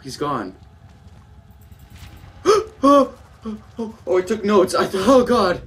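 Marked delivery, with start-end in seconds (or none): 2.8-5.6 gasp, then high, loud whimpers; panicky, broken exclamations; frightened stammer. Real, not acted. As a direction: stumble over words in real fright. Second scare in game.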